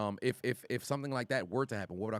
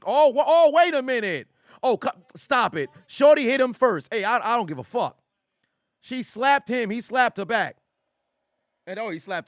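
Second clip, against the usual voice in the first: much higher, louder stunned outburst; shocked excitement; 10 semitones higher, 11 dB louder than usual. Real, not acted. Streamer watching a shocking video, loud excited outburst. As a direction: react to the clip in loud disbelief.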